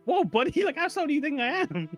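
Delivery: high-pitched mock offence; indignant protest. Mock-protests being called slow runner.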